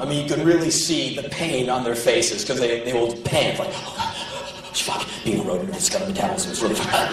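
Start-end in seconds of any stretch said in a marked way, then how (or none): 3.7-7.1 panicking rabbit. Retold or imitated.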